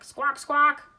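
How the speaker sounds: loud, high squawking call; penguin squawk. A narrator's reading. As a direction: call back like proud penguin parents. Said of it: "Roy and Silo called back".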